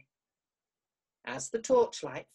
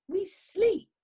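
squeaky insistence; 13 semitones higher, 6 dB louder than usual. Acted. Hedgehog insists.

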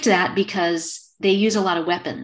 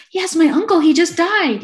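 high, loud, gushing mock amazement; amazed believer; 10 semitones higher, 6 dB louder than usual. Client of a psychic confirming the reading, amazed. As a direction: play an astonished, gullible client.

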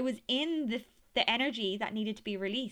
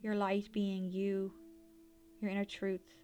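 airy breathy mystical healer. Acted. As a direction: gently guide them inward like a healer. Leads a guided meditation.

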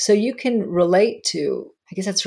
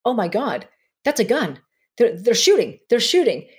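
higher-pitched inner "oh my God" panic, retold; mild alarm, pitched up on each phrase; 5 semitones higher than usual. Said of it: "I'm thinking to myself".

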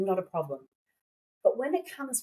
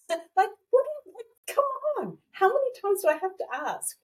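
laughing exasperation; 11 semitones higher than usual. Real, not acted. Mock despair at ignored sign.

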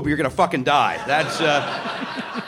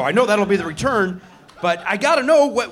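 ordinary talk, nothing marked

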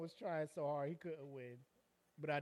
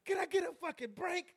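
high-pitched, exasperated begging; hammed-up cry for a breather; mock desperate plea; 12 semitones higher, 3 dB louder than usual. Man fleeing a brawl begs for relief, mock desperate.

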